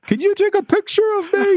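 very high-pitched, hammed-up yell; retold scream. Cyclist calling to a photographer he is riding toward, screamed. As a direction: scream it out in a shrill re-enactment. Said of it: "and I just screamed".

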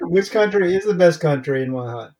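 said through a laugh. Real, not acted. Cohost jokes about which country to support, laughing. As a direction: laugh your way through the question. Joking about divided national loyalty.

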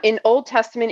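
ordinary talk, nothing marked

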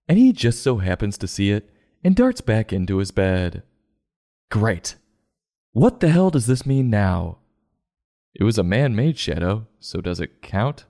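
ordinary talk

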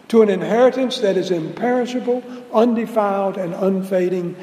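loud, ringing, fervent reading; scripture reading. Acted. Preacher reading Peter's letter to the congregation, fervent and loud. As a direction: proclaim the letter with rising fervor.

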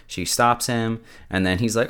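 ordinary talk, nothing marked

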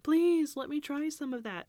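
high, pleading imitation of a child; retold begging. Child begging mom for cappuccino, mock pleading. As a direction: beg mom in a high little plea. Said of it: "I remember begging her".

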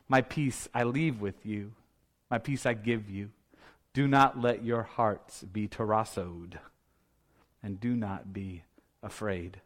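soft, low, hushed comfort; tender reassurance. Acted. Jesus leaving his disciples his peace, soft tender reassurance.

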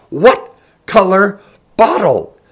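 slow, loud, high demand; furious roar. A narrator's reading. Woman presses Taryn about the bottle, roaring slowly. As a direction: roar it word by word.

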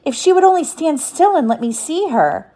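high, wishful acted plea; crying out. A narrator's reading. Wendy wanting to see the fairy, crying out. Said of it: "Wendy cried".

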